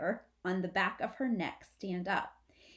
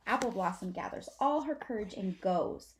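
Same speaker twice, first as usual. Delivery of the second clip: ordinary delivery, nothing marked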